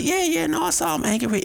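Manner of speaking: sudden high, sheepish admission; mock client. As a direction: squeak it out like a caught client. Client voiced answering therapist.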